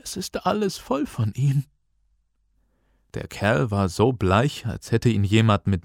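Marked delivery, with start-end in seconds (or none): 0.0-1.6 high, soft, frightened whimper. A narrator's reading. Teacher reporting the horde below, frightened. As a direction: voice a scared man, pitched way up.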